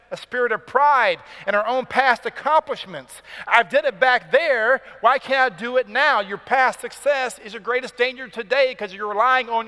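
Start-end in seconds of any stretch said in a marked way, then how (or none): 3.5-6.2 higher, louder indignant self-reliance; proud complaint. Acted. Preacher voicing a proud believer, indignant higher complaint. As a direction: sound like someone trusting past success.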